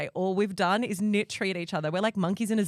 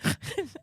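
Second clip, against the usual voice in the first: breathy laugh; 10 semitones higher than usual. Real, not acted. Laugh after girlfriend line.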